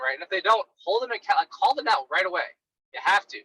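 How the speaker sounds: usual speaking voice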